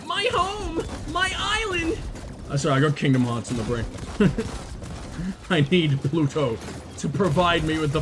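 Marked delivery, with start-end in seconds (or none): 0.1-2.0 high, far-off cry of longing; scream. Pretend. Gamer screaming for his lost island home. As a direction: wail it like a lost hero. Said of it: "As I scream".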